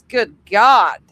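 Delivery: ordinary delivery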